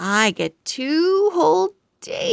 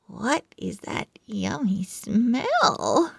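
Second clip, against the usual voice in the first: usual speaking voice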